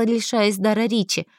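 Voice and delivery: ordinary delivery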